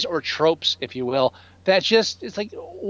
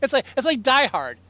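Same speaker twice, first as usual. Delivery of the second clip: ordinary talk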